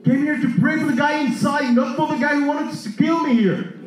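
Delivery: high-pitched comic protest; mock protest. Preacher replaying his objection to God, mock protest.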